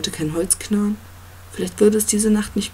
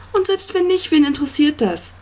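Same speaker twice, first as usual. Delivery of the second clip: much higher, louder yell; yelled back; 9 semitones higher, 5 dB louder than usual. A narrator's reading. Boy yells back at the monster, loud and high-pitched. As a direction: throw it back at him at full voice. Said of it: "schrie Connor zurück".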